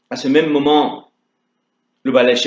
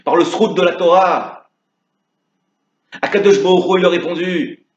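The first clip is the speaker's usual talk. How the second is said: fervent preaching; 6 semitones higher than usual. Acted. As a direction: drive the lesson home with fervour. Prédication passionnée finale. (prêche ardent et élevé)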